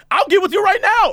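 cocky comeback shout. Retold or imitated. Guy blurts comeback in group argument.

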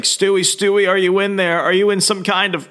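high, anxious pleading voice; worried brother. Retold or imitated. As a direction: plead with him to still be in there. Pleading with brother inside suit.

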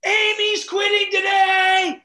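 high, drawn-out announcing yell; loud, slow, stretched shout; scream across store. Retold or imitated. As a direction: holler it to the whole store. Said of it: "Scream across the store".

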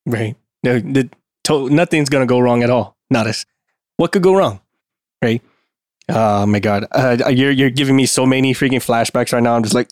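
normal talking voice

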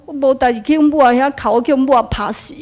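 loud incredulous protest; indignant retort. Retold or imitated. Speaker protesting to the master, loud and incredulous. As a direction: push back, offended at the idea.

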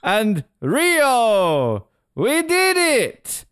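milk it like a nervous host; hammed-up proud speech; mock proud official. Mocking the Rio president's speech.

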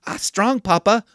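put-on high, bright little-girl squeal of Papa; granddaughter's voice. Grandfather quoting his little granddaughter on the ottoman, high childlike voice. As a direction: pitch way up like a small granddaughter. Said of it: "and she's like".

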